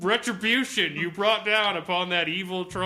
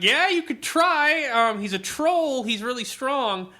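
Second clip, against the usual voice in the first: mock patient game master; 8 semitones higher, 4 dB louder than usual. GM humoring a bloodthirsty player.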